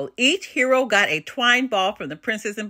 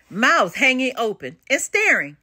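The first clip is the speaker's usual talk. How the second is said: ordinary delivery, nothing marked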